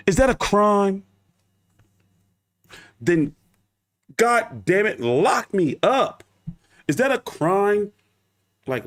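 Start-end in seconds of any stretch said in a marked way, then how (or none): none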